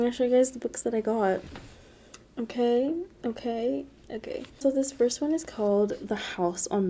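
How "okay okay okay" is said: each okay softer, trailing away; fading okays. Real, not acted. Vlogger settling down before showing books, fading. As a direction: let the okays die out.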